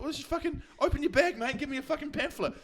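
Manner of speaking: high-pitched exasperated demand; re-enacted heated plea; retold arguing. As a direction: act out losing patience in an argument, pitch jumping right up. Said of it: "we had this argument".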